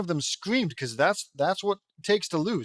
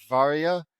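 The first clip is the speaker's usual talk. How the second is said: ordinary delivery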